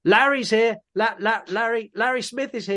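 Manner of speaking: high-pitched calling out of a name; mock announcing. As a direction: imitate someone announcing a name to the whole room. Mimics announcing someone's name to the room.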